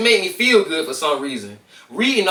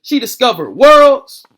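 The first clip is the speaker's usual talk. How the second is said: louder, slow, awed build; building wonder; 5 semitones higher, 9 dB louder, slower than usual. Acted. Narrator reaching the story's high point, slow and swelling. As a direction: swell up with wonder on each word. Climax of reading montage.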